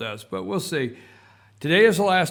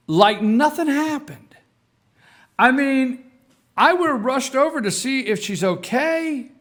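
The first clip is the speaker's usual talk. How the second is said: incredulous outrage; 7 semitones higher, 5 dB louder than usual. Real, not acted. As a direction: pitch it way up, appalled and half laughing by the end. Incredulous retelling of the fall.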